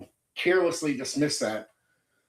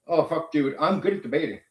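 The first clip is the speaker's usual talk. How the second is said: usual speaking voice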